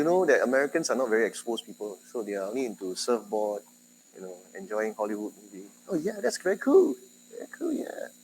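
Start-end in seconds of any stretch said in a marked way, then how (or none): 5.9-8.1 high, airy mock-impressed American gush. High mock-impressed American gush. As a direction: lecturer mocking a dazzled American.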